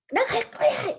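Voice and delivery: imitating a petulant outburst; storming off. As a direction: toss it off like a sulking child leaving. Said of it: "이러면서 확 가버리고".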